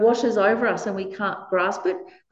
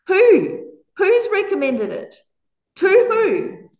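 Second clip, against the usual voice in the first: indignant questions; 10 semitones higher, 5 dB louder, slower than usual. Pretend. Mocking the faceless passive sentence.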